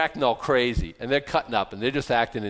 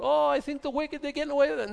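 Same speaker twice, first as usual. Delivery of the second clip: mock complaint; 13 semitones higher, faster than usual. Voicing a doubter's complaint.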